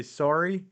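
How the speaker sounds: "sorry" said the Canadian way, rising at the end. Shown as a demonstration. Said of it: "bugging me for how I say".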